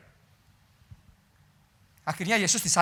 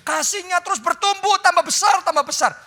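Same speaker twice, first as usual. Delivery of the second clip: building excitement; 10 semitones higher, 6 dB louder than usual. Acted. Growing love, building climax.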